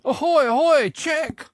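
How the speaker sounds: high, loud ringing call; loud shout. A narrator's reading. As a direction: call out across a field. New knight calling out.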